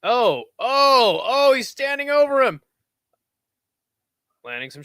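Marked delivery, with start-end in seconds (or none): 0.0-2.6 higher, louder excited oohs; rising excitement. Real, not acted. Commentator watching a fighter stand over his opponent, excited. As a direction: react live to a fighter pouncing. Live call of ground attack.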